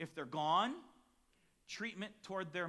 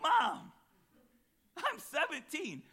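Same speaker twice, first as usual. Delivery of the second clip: high, louder, stretched-out complaint; whiny teen protest; 11 semitones higher, 8 dB louder, slower than usual. Retold or imitated. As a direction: plead like a shocked seventeen-year-old.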